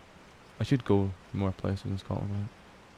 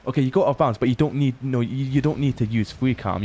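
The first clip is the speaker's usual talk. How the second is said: emphatic correction; 4 semitones higher, 5 dB louder than usual. Real, not acted. Firm correction to chat.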